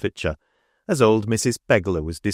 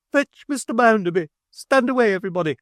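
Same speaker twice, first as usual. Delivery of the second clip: excited cry; 14 semitones higher, faster than usual. A narrator's reading. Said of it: "cried Missus Sparsit".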